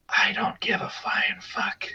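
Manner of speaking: whispered, slow and flat, not caring at all; deadpan whisper. Retold or imitated. Shrugging off a show-off metal fan, whispered. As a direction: breathe it out like a shrug, no voice. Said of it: "I'm like".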